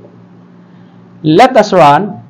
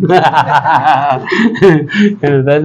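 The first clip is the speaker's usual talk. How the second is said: long, lower chuckling laugh; amused laugh; 6 semitones lower than usual. Real, not acted. Pastor amused by his talk with his wife, long laugh. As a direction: laugh on at the retold exchange.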